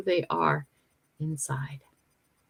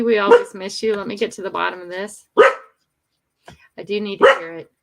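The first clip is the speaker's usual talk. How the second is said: normal talking voice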